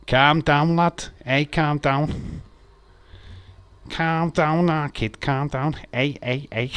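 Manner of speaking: calm a mate down the Scouse way; Liverpool accent. Pretend. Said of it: "So it's a Liverpool thing".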